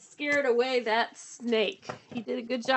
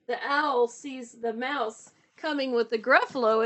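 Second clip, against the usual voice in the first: plain reading voice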